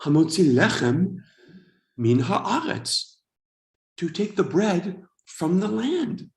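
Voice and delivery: slow, phrase-by-phrase blessing as it sinks in; dawning realization. Retold or imitated. Immigrant finally understanding the Hebrew blessing, dawning realization. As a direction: recite it slowly, discovering each word's meaning. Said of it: "suddenly it hits me".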